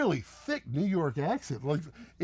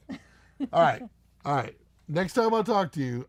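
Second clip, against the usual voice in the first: ordinary talk, nothing marked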